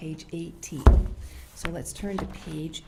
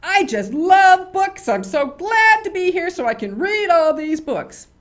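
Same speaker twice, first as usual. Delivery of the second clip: much higher and louder, gushing; overexcited loud gushing; 10 semitones higher, 13 dB louder than usual. Shown as a demonstration. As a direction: blurt it out far too loud for a library. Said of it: "do you walk in and say".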